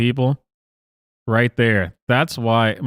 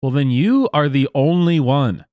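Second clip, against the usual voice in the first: normal talking voice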